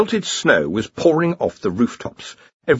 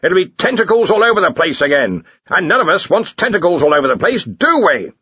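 higher, forceful scolding; berating; 7 semitones higher, 4 dB louder than usual. A narrator's reading. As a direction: hammer the point home at a subordinate. Archchancellor keeps berating subordinate.

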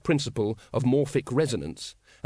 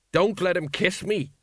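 higher, alarmed squeak; 6 semitones higher than usual. A narrator's reading. Sergeant fending off the librarian's kiss, squeaking. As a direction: plead in a raised voice. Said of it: "squeaked Colon".

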